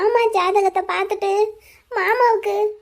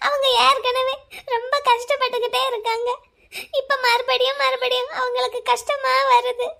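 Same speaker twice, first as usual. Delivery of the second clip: crying; 3 semitones higher than usual. Acted. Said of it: "இப்ப எதுக்கு அழற?".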